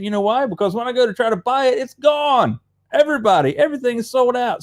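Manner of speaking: fired up that it's always sold out; worked-up rant. Real, not acted. Fishing vlogger griping to viewers about empty shelves, excited rant.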